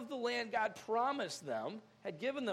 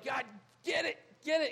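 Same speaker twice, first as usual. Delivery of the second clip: higher, insistent begging; impatient plea; 4 semitones higher than usual. Acted. People demanding that God act, impatient plea. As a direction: press God urgently to act. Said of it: "Otherwise, we're like".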